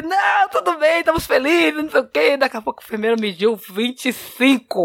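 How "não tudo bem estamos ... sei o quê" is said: high, brushing-off mock reassurance; put-on cheeriness. Uncle assures the nurse he is fine, put-on cheeriness. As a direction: wave away concern in a raised voice.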